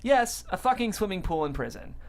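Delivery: ordinary delivery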